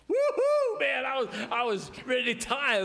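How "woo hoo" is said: high, loud "woo-hoo"; reenacted celebratory holler, pitched way up; excited whoop. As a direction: whoop it up over great news. Reenacts joy at big raise.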